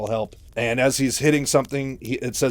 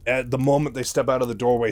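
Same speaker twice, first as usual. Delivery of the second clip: ordinary delivery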